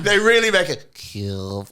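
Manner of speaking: Kiev said with a broken-off local vowel, "Kiev" as kʰɪv; stressed odd Kiev; shifted Kiev. Shown as a demonstration. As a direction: say "Kiev" flat and clipped out of the accent. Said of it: "they break their accent for a second".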